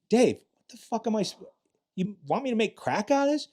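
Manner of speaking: high-pitched retold disbelief; incredulous protest. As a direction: challenge him as if he can't be serious. Retold protest over bad cocaine.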